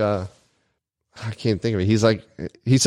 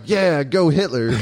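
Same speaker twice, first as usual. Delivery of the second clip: drawn-out mock cheering, pitched higher; 6 semitones higher, slower than usual. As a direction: mimic a slow sarcastic hurrah. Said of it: "don't think I ever went".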